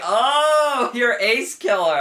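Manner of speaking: high, loud drawn-out "oh"; mock surprised realization. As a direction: exaggerate the moment it dawns on you. Acting out his own sudden realization.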